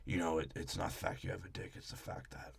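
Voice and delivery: hushed unvoiced aside; mock whisper. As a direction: whisper it like a guilty secret. Imagined Vin line whispered.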